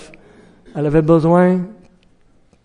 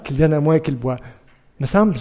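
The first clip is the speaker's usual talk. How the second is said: usual speaking voice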